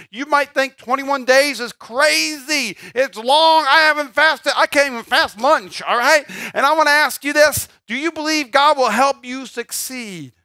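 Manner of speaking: high-pitched, loud preacher's exhortation; rousing preaching. Real, not acted. Preacher challenges congregation on the fast, rousing exhortation. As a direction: push it out hard and high, preaching.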